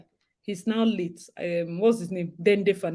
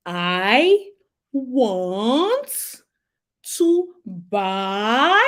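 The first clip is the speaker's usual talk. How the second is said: very slow, drawn-out, word by word with pauses; mock slow speech; 4 dB louder, much slower than usual. Speaker to someone who didn't understand, mockingly slow. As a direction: spell it out slowly as if to someone with no sense. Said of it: "You speak slowly like they, they don't have sense".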